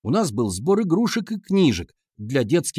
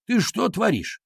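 mildly indignant, higher-pitched rebuke; 8 semitones higher, slower than usual. A narrator's reading. Teacher to boy throwing his backpack, mildly indignant. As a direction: demand an explanation, voice pitched up. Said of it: "возмутился Егор Васильевич".

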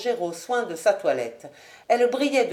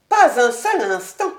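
higher, firm refusal; proud lady, no compromise; Lady Ashton; 3 semitones higher, 7 dB louder than usual. Acted. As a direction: cut him off flatly.

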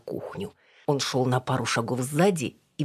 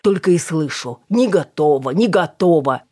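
mocking complaint; 2 semitones higher, 8 dB louder than usual. A narrator's reading. Edik mocks her refrain.

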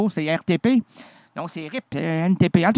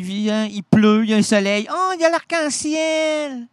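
storytelling; 3 dB louder, slower than usual. Acted. Animateur raconte un conte d'arc-en-ciel.

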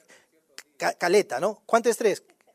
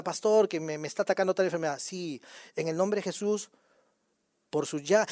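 acted pastoral exchange; 5 semitones lower, 9 dB quieter than usual. Acts out person and pastor praying.

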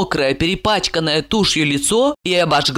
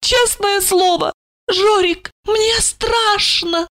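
put-on drunk frightened woman. A narrator's reading. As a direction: plead fear in a high, slowing voice. Drunk woman confesses fear.

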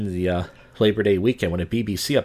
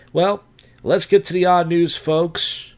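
announcer voice; 7 semitones higher, 3 dB louder, slower than usual. Acted. Announcing the news segment.

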